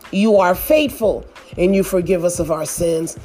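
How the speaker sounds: ordinary talk, nothing marked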